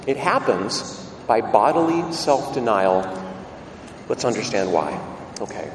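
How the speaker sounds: usual speaking voice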